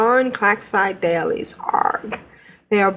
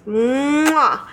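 blowing an air kiss; 2 semitones higher than usual. Real, not acted. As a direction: blow a kiss to listeners. Kiss sound ending letter.

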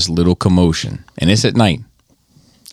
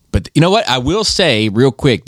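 usual speaking voice

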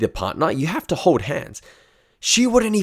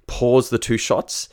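usual speaking voice

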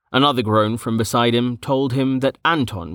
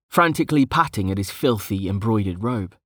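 plain reading voice

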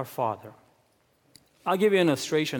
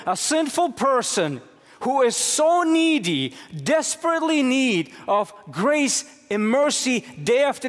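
impassioned preaching; 7 semitones higher than usual. Acted. Pastor asking congregation how sinners can please God, impassioned and high. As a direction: build with mounting urgency.

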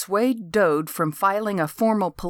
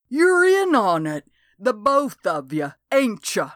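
put-on higher, louder accusing old man; suspicious accusation; 4 semitones higher, 3 dB louder than usual. Old man accuses the sheriff and deputy, loud and suspicious. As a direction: jab the blame at both of them.

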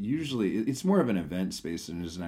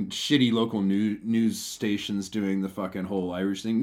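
ordinary delivery

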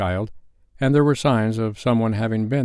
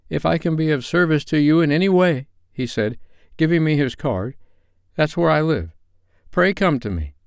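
lightly voiced, higher-pitched Bob quoting a kind gentleman; grateful Bob; 7 semitones higher than usual. A narrator's reading. Bob repeats the nephew's offer to his family, lightly voiced, grateful.

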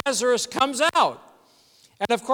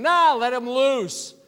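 mock command; 5 semitones higher, 6 dB louder than usual. Acts Jesus ordering the onlookers.

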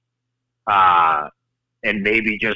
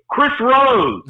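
higher, louder call from far away; 5 dB louder than usual. Retold or imitated. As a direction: shout the name after them across the mall. Calling name across the distance.

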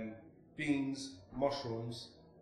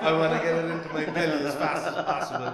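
loud, higher, laughter running through it; laughing; 5 semitones higher, 9 dB louder than usual. Real, not acted. Guest explaining why he skips toast, laughing. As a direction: laugh your way through the line. Joking about eating bread quickly.

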